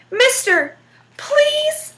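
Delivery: high, whiny begging plea; devil pleading. Acted. The trapped devil begs Jack to let him go, high and whiny. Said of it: "Please, so pretty".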